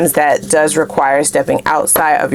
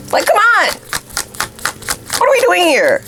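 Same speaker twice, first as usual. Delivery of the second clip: exasperated; 12 semitones higher than usual. Real, not acted. Exasperated at viewers overworking.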